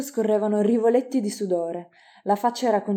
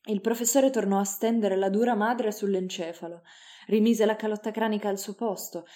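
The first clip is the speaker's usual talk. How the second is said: ordinary delivery, nothing marked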